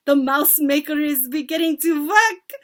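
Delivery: high, loud gloating cry; exultant witchy scream; witch shrieking. A narrator's reading. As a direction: shriek it in triumph. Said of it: "shrieked the Grand High Witch".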